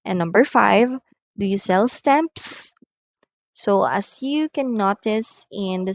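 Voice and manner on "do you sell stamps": yes-no question climbing on "stamps"; rising intonation. Shown as a demonstration. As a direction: lift "stamps" at the end. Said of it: "are going up".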